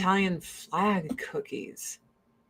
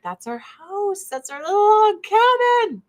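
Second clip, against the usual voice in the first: higher and louder, gushing over a tiny cabin; excited gushing; 11 semitones higher, 5 dB louder than usual. Retold or imitated. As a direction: squeal it like it's your own home. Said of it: "I'm like".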